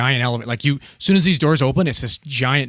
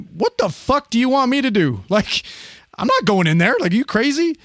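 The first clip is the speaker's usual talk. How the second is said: higher, pitched-up disbelief; a retold freak-out, pitched up high; incredulous; 9 semitones higher than usual. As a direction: balk at the idea, voice climbing. Panicked refusal to enter room.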